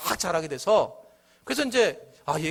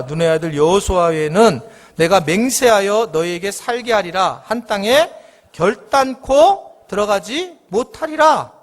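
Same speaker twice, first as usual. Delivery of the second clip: slow, weighty reading that grows louder to the end; solemn scripture reading; 5 semitones lower, 4 dB louder, slower than usual. Acted. Pastor reads God's oath to the congregation, slow and solemn. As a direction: proclaim each verse like a judgment.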